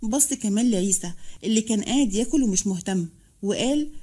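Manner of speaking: plain reading voice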